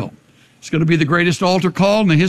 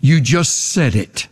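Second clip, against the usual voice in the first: voice of the enemy; 8 semitones lower, slower than usual. Retold or imitated. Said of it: "and the enemy says".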